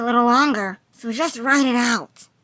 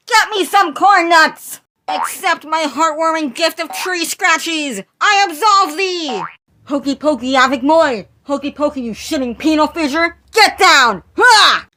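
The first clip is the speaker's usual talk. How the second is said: loud, high crazed proclamations; unhinged shouting lunatic; manic ranting madman; 5 semitones higher, 6 dB louder than usual. Acted. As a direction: rant like a deranged prophet. Noodle Boy keeps ranting.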